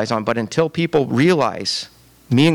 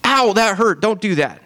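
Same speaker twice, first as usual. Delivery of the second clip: high-pitched mock "Ow" of a pruned branch; mock whine of pain; 7 semitones higher than usual. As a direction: play the hurt branch, whining. Mock complaint about being pruned.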